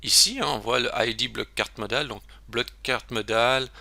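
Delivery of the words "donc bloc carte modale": normal talking voice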